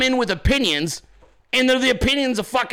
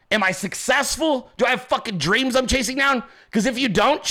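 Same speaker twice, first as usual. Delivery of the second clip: normal talking voice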